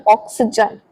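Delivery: ऑक्सीजन pronounced carefully; correct oxygen. Shown as a demonstration. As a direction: stress ऑक्सीजन clearly, no 'सजन' clipping. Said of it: "ऑक्सीजन नहीं बोलना".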